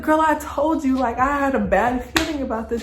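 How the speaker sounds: high-pitched, insistent I-told-you-so; retold reproach. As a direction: scold a friend in replay. Acted scolding reply to friend.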